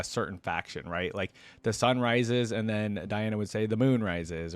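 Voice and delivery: ordinary talk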